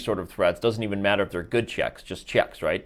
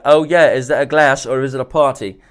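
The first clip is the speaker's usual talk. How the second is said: put-on English accent, pushed louder; 3 semitones higher, 7 dB louder than usual. Chess streamer joking about his mustache, in a mock English accent. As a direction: attempt an English accent on a jokey line. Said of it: "in an English accent".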